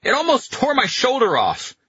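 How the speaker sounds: high-pitched furious complaint; angry. A narrator's reading. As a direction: yell back in angry protest. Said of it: "Carletto said angrily".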